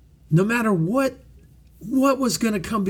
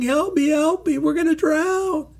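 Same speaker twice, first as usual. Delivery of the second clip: mock panicked scream; 9 semitones higher than usual. Preacher mocking panicked believers in the storm, mock panicked cry. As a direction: mimic frightened disciples half-yelling for rescue. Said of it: "just screaming".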